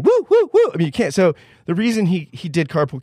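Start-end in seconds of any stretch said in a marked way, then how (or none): 0.0-0.7 high, loud mock whoops; mock crowd whooping. Host imitating a noisy studio crowd, with loud high mock whoops. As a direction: whoop like a rowdy studio audience. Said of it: "people in the studio going".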